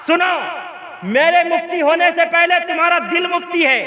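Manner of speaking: high-pitched, driving sermon tirade; relentless rhetorical list, building in force; preaching at full force. Real, not acted. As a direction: hammer each accusation home to the crowd.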